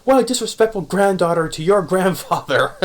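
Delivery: put-on a touch of a huffy old retainer; old Miyoga scolding. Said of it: "Miyoga's like".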